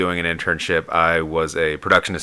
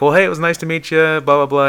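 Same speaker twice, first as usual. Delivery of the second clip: high, louder imitated farewell; friendly goodbye; 11 semitones higher, 6 dB louder than usual. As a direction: rattle off a breezy goodbye in a raised voice. Retold friendly goodbye by cartoonist.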